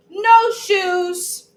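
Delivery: loud high stubborn shout. Acted. Toddler refusing her shoes, shouting high and loud.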